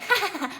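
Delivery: high mocking cackle; gleeful poltergeist laugh. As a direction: cackle at Filch. Peeves laughs.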